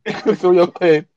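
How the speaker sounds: high, loud, laughing manager voice; a mock sympathetic coach; pitying manager. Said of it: "looks at you from the sideline and says".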